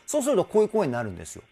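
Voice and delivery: ordinary delivery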